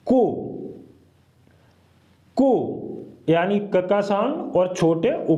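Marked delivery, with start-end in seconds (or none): 0.1-2.5 कु twice, short and clear; model syllable. Shown as a demonstration. Teacher to students, repeating कु. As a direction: sound out कु. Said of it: "कैसे बोलेंगे?".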